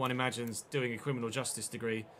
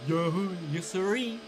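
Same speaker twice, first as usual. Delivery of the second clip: higher mock Southern US voice, amused; Southern American accent; 3 semitones higher, 4 dB quieter than usual. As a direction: ham up a friendly Tennessee local. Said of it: "When we went to Tennessee on holiday".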